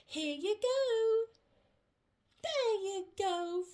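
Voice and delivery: plain reading voice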